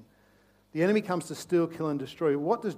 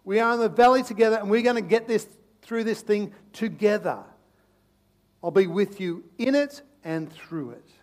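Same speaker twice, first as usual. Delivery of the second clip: loud, higher, firm reassurance; reassuring shepherd; 6 semitones higher, 9 dB louder than usual. Acted. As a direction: promise them loudly you'll stay with them. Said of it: "He says".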